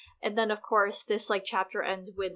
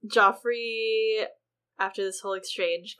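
normal talking voice

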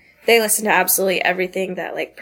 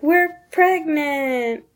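retold excited news; 6 semitones higher, much slower than usual. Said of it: "And they're like".

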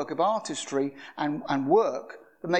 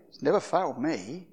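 ordinary talk, nothing marked

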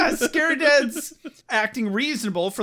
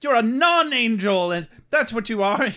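loud, high, melodramatic outrage; hammed-up affronted wailing; mock indignant mom; 5 semitones higher, 5 dB louder than usual. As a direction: scold like a wronged mother in a melodrama.

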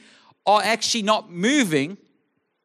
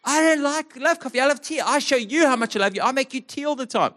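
higher, exasperated protest; wife's protest; 6 semitones higher than usual. Retold or imitated. Wife answers husband in their argument, high exasperated. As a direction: pitch it up and push back. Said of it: "And Jess goes".

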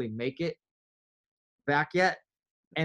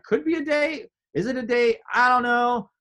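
unsure inner voice; 9 semitones higher, 5 dB louder than usual. Retold or imitated. Unsure inner voice about date.

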